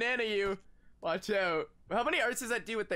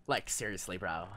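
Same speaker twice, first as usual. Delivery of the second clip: put-on valley girl voice; 14 semitones lower, 4 dB quieter than usual. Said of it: "Talk like a valley girl".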